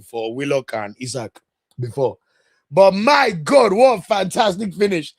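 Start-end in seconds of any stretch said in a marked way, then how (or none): 2.7-5.1 loud, raised exclamation of awe; amazed admiration. Real, not acted. Pundit reacting to a fantastic finish, amazed admiration. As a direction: marvel at a brilliant goal with real force. Marvels at a goal.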